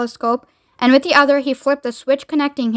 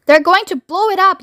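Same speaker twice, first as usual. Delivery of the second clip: high, raised cry of alarm; alarmed yell; 7 semitones higher, 4 dB louder than usual. A narrator's reading. Ground control fearing for the hotel, alarmed yell. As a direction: yell out the danger. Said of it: "yelled ground control".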